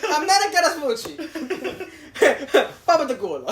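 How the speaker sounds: mock Italian accent with laughter; fake Italian. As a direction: gibberish Italian, hammed up. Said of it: "I have to make up a fake Italian name".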